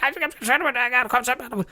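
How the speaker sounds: very high, jumbled imitation of a frantic mom. Son mimicking his mother jumbling words in panic, very high. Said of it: "every word would turn into one, one long word".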